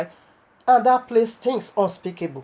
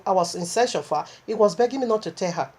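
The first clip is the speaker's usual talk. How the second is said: usual speaking voice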